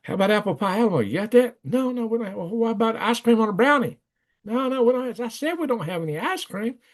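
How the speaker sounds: retold diner exchange. Retold diner quarrel, two voices.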